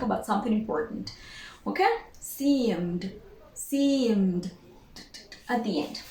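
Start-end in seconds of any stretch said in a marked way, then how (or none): 2.2-4.5 "seemed" ending M-D, one syllable; silent E. Shown as a demonstration. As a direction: model "seemed" with a D right after M. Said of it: "we produce the D".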